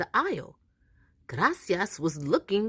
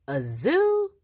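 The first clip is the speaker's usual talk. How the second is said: high, startled rising question; surprised question; 10 semitones higher, 4 dB louder than usual. A narrator's reading. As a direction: sound astonished. Boy surprised about zoo.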